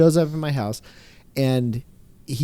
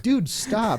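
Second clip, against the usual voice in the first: mock yell; 9 semitones higher, 3 dB quieter than usual. Seller telling the buyer to quit revving, mock yell. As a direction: yelp it as a held-back mock shout. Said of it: "I'm yelling at the guy".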